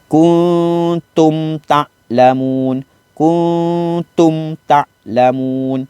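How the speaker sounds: kuntum ta'lamun drawn out, clear closed mim in kuntum; slow Quran recitation. Shown as a demonstration. Ustaz showing pupils clear mim, slow recitation. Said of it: "Ha, jelas".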